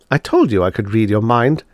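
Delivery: plain reading voice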